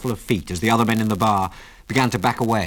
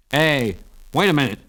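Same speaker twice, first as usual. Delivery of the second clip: sharp bartender's protest, raised pitch; snapped; 5 semitones higher than usual. A narrator's reading. Bartender stops a gunfight, snapped. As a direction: cut in with a mild snap. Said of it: "snapped the bartender".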